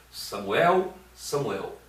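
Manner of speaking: normal talking voice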